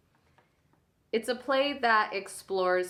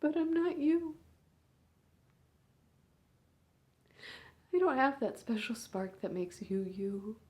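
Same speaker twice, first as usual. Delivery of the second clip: a big sister at a hospital bedside, swinging between hushed and raw; hushed, aching bedside monologue; grieving big sister; 3 semitones higher, 4 dB louder than usual. Acted. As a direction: speak to your unconscious little sister, holding back tears. Sister talks to comatose sibling.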